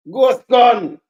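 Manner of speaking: calls the name out loud; loud shout-out. Real, not acted. Streamer hailing a viewer by name, loud call.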